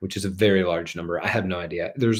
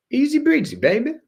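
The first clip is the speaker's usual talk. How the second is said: much higher, playful singsong; high sing-song; 4 dB louder than usual. Acted. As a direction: toss it off breezily. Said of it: "playful sing-song".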